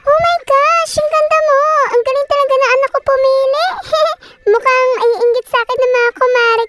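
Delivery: high-pitched, delighted fussing; gushing mother. Acted. Mother meeting her son's girlfriend, gushing. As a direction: gush over a welcome guest.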